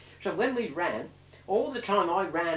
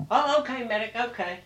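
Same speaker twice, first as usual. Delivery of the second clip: mock sailor conceding; 6 semitones higher, 5 dB louder than usual.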